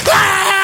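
a high, sudden shriek of fear; mock terrified shriek. Frightened cop shrieks at the word Constitution. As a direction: shriek like a cop who has seen a ghost. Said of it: "They're like".

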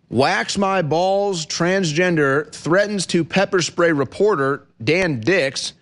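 slow, deliberate headline read; slow emphatic read. Real, not acted. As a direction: draw out each word of the headline. Reading headline slowly.

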